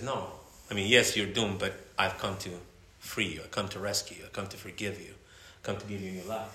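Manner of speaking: soft, low, gentle reassurance; tender voice of Jesus. Acted. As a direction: speak it like a gentle rescuer.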